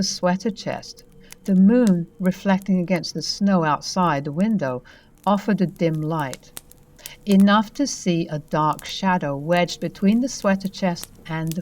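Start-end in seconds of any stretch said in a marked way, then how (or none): none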